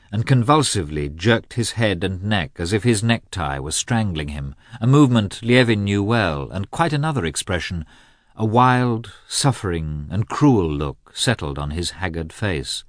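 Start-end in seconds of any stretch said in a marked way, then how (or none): none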